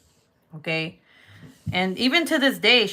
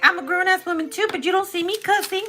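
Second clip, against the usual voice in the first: much higher, fast sassy mom voice; mom retort; 11 semitones higher, faster than usual. Retold or imitated. Mom to daughter, mocking her words, much higher and fast. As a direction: throw her words back. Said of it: "She's like".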